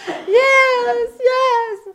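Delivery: high, loud, drawn-out mock bawl; imitated crying wail. Crying girl agreeing to believe, imitated wail. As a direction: wail the answer, hammed-up.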